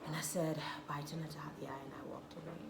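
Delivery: barely voiced, hushed retelling; hushed as the hurt sinks in; whispered aside. Real, not acted. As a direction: whisper it softly. Quiet retelling of leaving.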